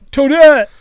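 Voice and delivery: loud, high cartoon holler; cartoon character shout. Friends riffing on Toad's voice, loud high shout. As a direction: holler the name as a little game creature. Mimicking Toad calling out.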